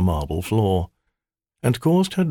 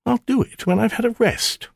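higher, sulky temptress voice; sulky maiden. Acted. Spirit complaining to her master, sulky. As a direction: pout at the demand.

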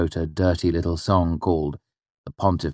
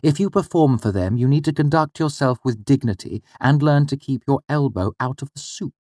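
a lightly voiced mother, firm and higher; 8 semitones higher than usual. The mother insisting her son learn manners, in a firm voice. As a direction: lecture him gently but firmly. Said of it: "my mother said firmly".

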